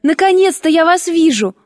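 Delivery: high, delighted exclamation; rapturous. A narrator's reading. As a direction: greet a long-missed idol with joy. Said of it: "с неудержимым порывом восторга".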